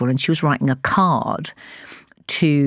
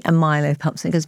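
ordinary talk, nothing marked

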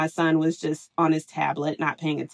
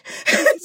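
laugh; 10 semitones higher than usual. Real, not acted. Laughing at own story.

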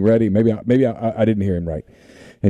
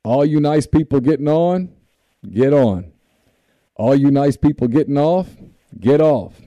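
higher conductor announcement; train conductor call; 5 semitones higher than usual. Retold or imitated. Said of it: "He pulls it up".

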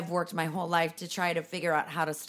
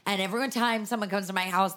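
usual speaking voice

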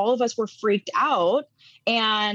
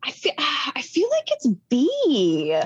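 whispering; 5 semitones higher than usual. Real, not acted. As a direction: whisper the guess after a sigh. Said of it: "sighs, whisper".

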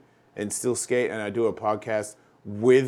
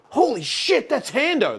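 high-pitched excited recognition; retold starstruck amazement; 12 semitones higher, slower than usual. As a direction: re-enact spotting your movie idol. Retells spotting a film star.

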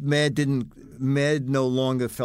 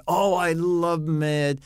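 higher, drawn-out mock gushing; gushing fan; 6 semitones higher, slower than usual. Retelling a comedian's praise of the magazine, imitated gushing. Quoting Jerry Seinfeld's enthusiastic praise.

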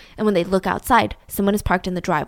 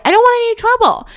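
high, fast, loud protest of innocence; pleading innocent; 12 semitones higher, 7 dB louder, faster than usual. Acted. Father pleading with police officers, high and fast. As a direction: play the wronged victim.

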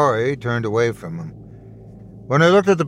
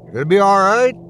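louder and higher, calling across the bar; called out; 6 semitones higher, 6 dB louder, faster than usual. A narrator's reading. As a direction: call after him as he leaves. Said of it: "the bartender called after him".

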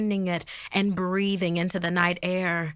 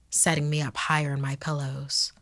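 usual speaking voice

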